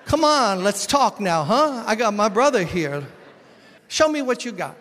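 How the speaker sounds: loud, high swaggering dare; cocky taunt. Retold or imitated. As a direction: strut it like a kid backed by his big brother. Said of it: "I was like".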